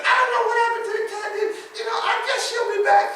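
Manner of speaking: put-on old church lady. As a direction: play a sweet, puzzled elderly sister. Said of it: "It was the sister pastor".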